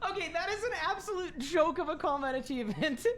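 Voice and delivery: can't keep a straight face; laughing. Real, not acted. Laughing at an absurd achievement.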